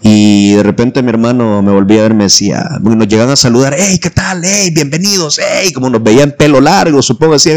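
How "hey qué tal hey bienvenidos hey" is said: higher, softer mock hellos; imitated friendly greeters. Church youths greeting long-haired newcomers, imitated friendly hellos.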